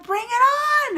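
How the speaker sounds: high, gung-ho whoop; excited cheer. Retold or imitated. Fan cheering a female Doc Ock, excitedly. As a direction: call it out like a thrilled fan.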